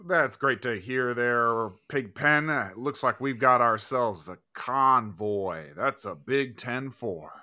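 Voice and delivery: loud, slow CB trucker voice; over walkie talkie. Pretend. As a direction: play a trucker on the radio. CB radio trucker talk continues.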